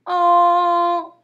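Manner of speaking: "An" held long, high and loud; isolated sound. Shown as a demonstration. Teacher isolating a sound for pupils, "An" held long. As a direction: sing out "An" on one long note.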